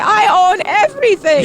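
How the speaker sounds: put-on high, loud-ish Vietnamese-accented boast; hammed-up indignant Vietnamese accent; mock Vietnamese accent. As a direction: imitate a ranting man in a Vietnamese accent. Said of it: "the way he was going".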